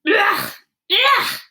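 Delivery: a giant choking on vile food, roaring; disgusted roaring. A narrator's reading. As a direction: roar out the disgust. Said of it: "roared the blood bottler".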